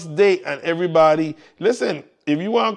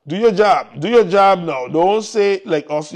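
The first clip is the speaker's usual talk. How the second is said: ordinary talk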